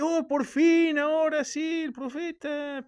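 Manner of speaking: mock rapture. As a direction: hail the prophet in a hammed-up ecstatic voice. Believers hail their new prophet.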